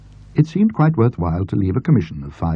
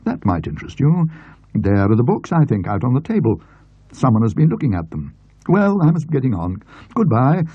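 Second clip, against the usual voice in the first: lightly voiced talkative gentleman, higher and quick; a hint of a brisk, sociable bookish acquaintance; chatty friend; 6 semitones higher than usual. A narrator's reading. Talkative friend to Mister Denton at the book sale, lightly voiced. Friend finds the lot, leaves.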